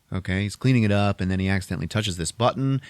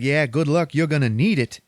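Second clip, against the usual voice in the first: higher, louder ribbing; cocky higher voice, a bit louder; teasing friend; 6 semitones higher, 4 dB louder than usual. Retold or imitated. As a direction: rib your buddy on the way out. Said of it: "He's like".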